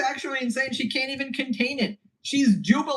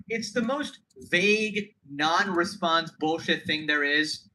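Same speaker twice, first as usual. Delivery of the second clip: ordinary delivery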